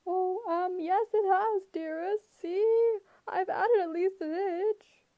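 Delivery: high, loud nervous falsetto. A narrator's reading. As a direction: squeal it like a fake bride. Grover in bride falsetto.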